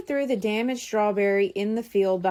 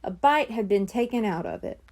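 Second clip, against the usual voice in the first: usual speaking voice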